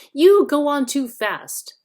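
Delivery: loud, urgent objection. A narrator's reading. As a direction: cut in with urgency.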